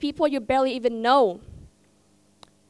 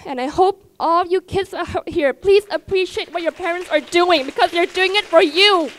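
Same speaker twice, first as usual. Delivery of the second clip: higher and louder, pleading with feeling; real emotion; 5 semitones higher, 5 dB louder than usual. Real, not acted. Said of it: "let's not get so emotional".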